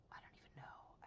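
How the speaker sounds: barely-there whisper; hushed, awed aside; overwhelmed whisper. Real, not acted. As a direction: whisper it as if lost for words. Overwhelmed by soft yarn.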